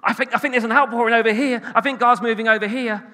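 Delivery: high, excitable caught-by-a-squirrel voice; mock excitable spirit person chasing the next thing; distracted excitement. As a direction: blurt it out high, attention jumping elsewhere. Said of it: "Week two, it's like".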